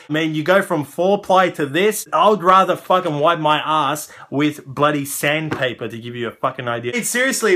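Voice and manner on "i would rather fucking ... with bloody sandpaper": ordinary talk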